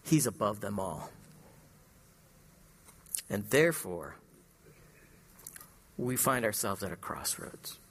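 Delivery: low and soft, slowing to a near hush; hushed reverent appeal; solemn hush. Acted. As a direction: let each word sink in quietly. Quiet solemn sermon close.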